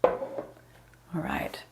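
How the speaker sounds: soft unvoiced whisper; just breathe the words out. Real, not acted. Instructor moving class on, under-the-breath whisper. Instructor quiet transition aside.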